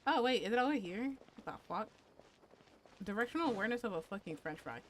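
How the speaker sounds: usual speaking voice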